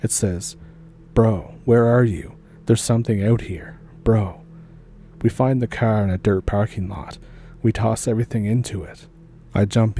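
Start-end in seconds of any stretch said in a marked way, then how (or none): none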